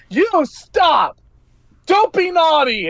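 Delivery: put-on high-pitched scolding little child; Martha. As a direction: tell off a monster like a five-year-old. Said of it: "Martha points at Karkolgon and is like".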